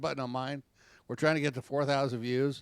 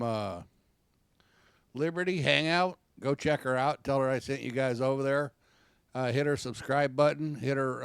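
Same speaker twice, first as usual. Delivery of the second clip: ordinary delivery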